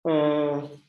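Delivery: ordinary talk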